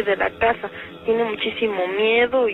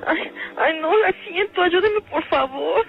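frightened pleading; 7 semitones higher than usual. Acted. Caller sees shadows coming.

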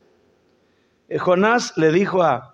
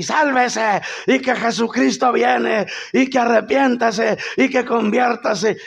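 imitating fiery preaching; 6 semitones higher than usual. The man preaches a memorized sermon to a crowd, mock fiery preaching. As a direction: belt it like a tent-meeting preacher. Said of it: "Y empezó a predicar y predique".

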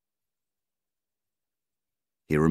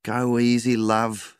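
higher, gentle plea to go easy; wife's voice; 8 semitones higher, 3 dB louder, slower than usual. A narrator's reading. Wife calming her angry husband, higher voice. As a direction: soothe him before he storms off.

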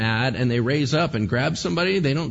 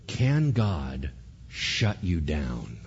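ordinary talk, nothing marked

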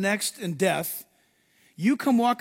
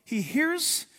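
usual speaking voice

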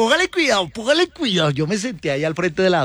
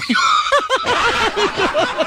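very high burst of laughter. Real, not acted. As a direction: shriek with laughter. Laughs at punch. (carcajada muy aguda)

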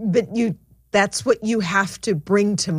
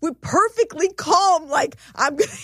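excited retelling; 7 semitones higher than usual. Retold or imitated. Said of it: "She's like".

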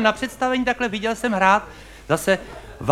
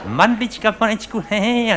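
mouse thinking aloud; 2 semitones higher, 6 dB quieter than usual. Retold or imitated. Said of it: "říká si".

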